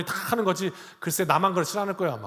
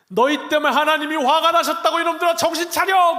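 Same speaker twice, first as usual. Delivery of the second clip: much higher and louder, shouting at the people; furious shout; 9 semitones higher, 6 dB louder than usual. Acted. Moses shouting at his people for angering God, furious. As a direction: yell the rebuke with all force.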